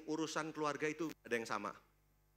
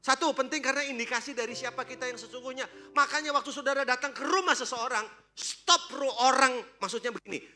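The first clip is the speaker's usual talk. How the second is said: much higher, louder sermon voice; full-force preaching; 10 semitones higher, 10 dB louder than usual. Acted. Preacher urging the congregation about homes, full-force preaching.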